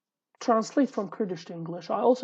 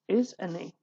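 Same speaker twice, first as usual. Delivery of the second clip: whisper. Real, not acted. Whispered aside.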